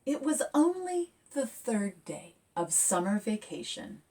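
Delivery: lively picture-book read; reading to children. A narrator's reading. Author reading her picture book aloud to kids, lively. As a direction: read it bright and expressive.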